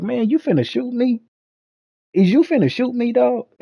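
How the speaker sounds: high, disbelieving challenge; retold stunned question to the cop; incredulous. Confronting cop with gun.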